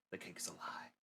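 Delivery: soft unvoiced whisper; whispered aside. Player whispering a joke aside, soft whisper.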